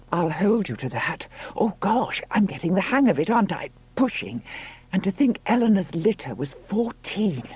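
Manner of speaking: high-pitched, effortful chatter; labouring Juno, game. Acted. Woman getting the hang of pushing, eager. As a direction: talk high and eager between pushes.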